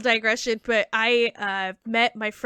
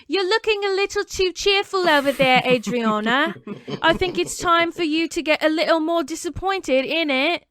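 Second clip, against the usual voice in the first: put-on higher-pitched British accent, teasing and arch; 5 semitones higher than usual. Comedian voices singer chiding [name] for being too cheerful, mock British. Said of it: "She'd be like".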